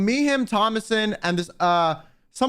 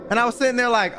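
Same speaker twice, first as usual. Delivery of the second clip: ordinary talk, nothing marked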